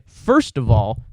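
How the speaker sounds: ordinary delivery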